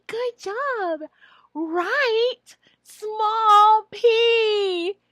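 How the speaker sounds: high, loud cheerful praise; teacher praise. Shown as a demonstration. Trainer shows teacher praise, high and loud. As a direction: praise the child with big energy. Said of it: "And you say".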